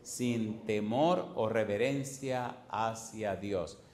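"Sin temor o reverencia hacia Dios" lento y marcado, palabra por palabra; díctalo despacio para que lo anoten; slow dictation. Shown as a demonstration. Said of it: "¿Sin qué cosa?". (dictado lento del maestro)

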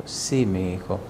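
low, slow, weak yes; a lightly voiced dying mother; mother on her deathbed, low and slow; frail dying mother.

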